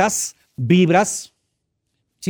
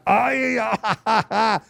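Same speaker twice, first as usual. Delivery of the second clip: mock ouch and whimper; 6 semitones higher, much slower than usual. Acting finger pain.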